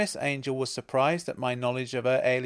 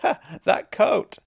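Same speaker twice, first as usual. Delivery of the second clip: high, loud words after a laugh; helpless laughter; 12 semitones higher, 9 dB louder than usual. A narrator's reading. Woman laughing at the narrator's coat, helpless. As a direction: squeal it out through acted giggles. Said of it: "She burst out laughing again".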